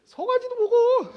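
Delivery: big, slow, high shout of delight; excited offer. Acted. Father shouting his generosity at the older son, slow and loud. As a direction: throw the whole calf at him, laughing loud.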